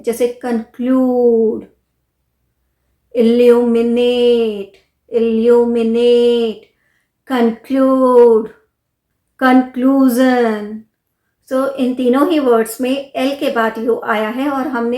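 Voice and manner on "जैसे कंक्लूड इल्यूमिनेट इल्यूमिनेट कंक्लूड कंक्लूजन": इल्यूमिनेट and कंक्लूड with a long drawn oo, slow; Hindi: L के बाद लंबा ऊ, धीरे बोलें; long oo after L. Shown as a demonstration. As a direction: stretch the oo in कंक्लूजन. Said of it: "long ऊ pronounce करते हैं".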